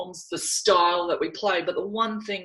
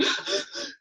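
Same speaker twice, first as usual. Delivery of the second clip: gasp; 5 dB quieter than usual. Retold or imitated. Counting names, getting worked up.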